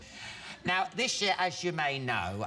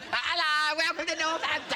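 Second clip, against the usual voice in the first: mock Northampton greeter; 11 semitones higher than usual. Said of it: "they must think everyone in Northampton".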